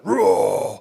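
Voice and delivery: loud imitated roar; forceful dad roar. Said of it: "Dad's gonna be like".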